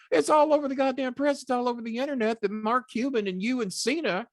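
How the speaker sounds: high, loud, exasperated demand; imitating a furious colleague's phone call; angry Cornette. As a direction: bark it like a furious boss on the phone. Cornette voiced ranting.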